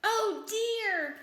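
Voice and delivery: high, loud storybook "oh dear"; worried little mole exclaiming; dismayed sigh. A narrator's reading. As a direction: lament it with exaggerated dismay. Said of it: "sighed Mole".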